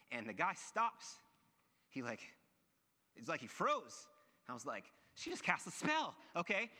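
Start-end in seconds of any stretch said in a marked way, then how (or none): 5.1-6.7 high, loud amazed exclamation; mock astonishment. Husband stunned as the robber froze, loud mock astonishment. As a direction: exclaim it with voice shooting up, retold amazement.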